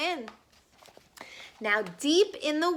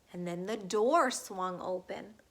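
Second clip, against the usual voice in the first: plain reading voice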